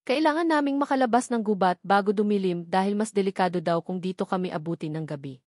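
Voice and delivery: ordinary delivery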